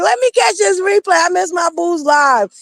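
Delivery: high, excited self-imitation; eager mock cry. Co-host retelling catching a boo's live replay, high excited mock cry. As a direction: draw it out high as it builds. Quoting own eager fan reaction.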